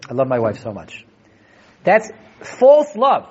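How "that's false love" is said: fiery preaching. Acted. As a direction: hammer the point home to the class. Rebbe preaching against selfish love.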